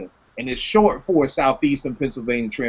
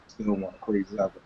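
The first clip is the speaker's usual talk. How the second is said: much softer, quicker repeat under his breath; quiet echo; 6 semitones lower, 11 dB quieter, faster than usual. Real, not acted. Host repeats his verdict to himself, softly and quickly. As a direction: murmur it again to himself, fast.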